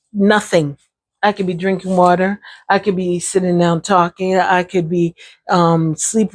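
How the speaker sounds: usual speaking voice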